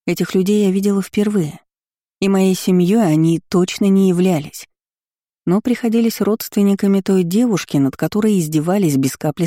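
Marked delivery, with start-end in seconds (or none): none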